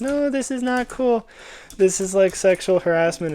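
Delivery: high girly put-on voice; girl voice. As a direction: squeak it out like a teen girl. Freshman girls rebuff the jock.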